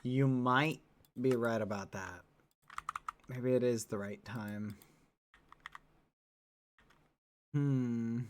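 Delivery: a big low voice mulling it over; deep character voice. Acted.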